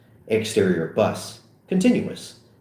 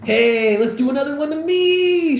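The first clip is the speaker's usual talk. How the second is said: higher, louder cocky cheer; pleased with himself, call for more; cocky excited; 5 dB louder than usual. Acted. Seth calling for a toast to himself, higher and cocky.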